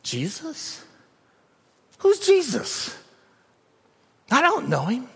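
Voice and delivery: high, mocking disbelief; a lightly voiced disciple pretending not to know Jesus; disciple denying Jesus. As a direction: play dumb loudly. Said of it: "is gonna say".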